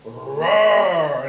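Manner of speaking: loud, low growling roar; tiger roar. Acted. Tiger turns on the moth and roars, loud.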